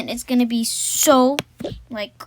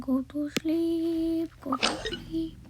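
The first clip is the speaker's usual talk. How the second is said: slow, higher, tender cooing; lullaby voice; 5 semitones higher, 7 dB quieter, slower than usual. Pretend. Kid lulling her teddy bear to sleep, tender. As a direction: coo it to the teddy like a sleepy baby.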